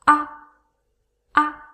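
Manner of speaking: "Ah" very light and very short; barely touch "ah", with little power; neutral tone. Shown as a demonstration. Teacher demonstrates the neutral tone, light short "ah". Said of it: "Very light, very short".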